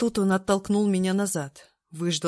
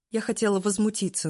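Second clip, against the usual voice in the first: plain reading voice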